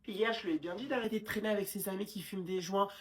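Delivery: usual speaking voice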